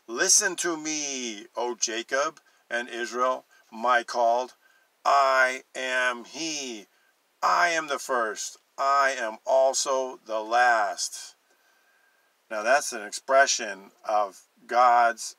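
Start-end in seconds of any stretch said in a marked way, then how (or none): 0.1-11.3 solemn scripture reading. Acted. Reading God's words passionately.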